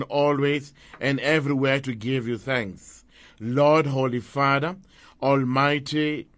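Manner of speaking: usual speaking voice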